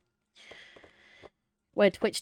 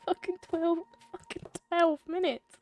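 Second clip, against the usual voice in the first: laughing; 12 semitones higher than usual. Real, not acted. Laughing at game timer.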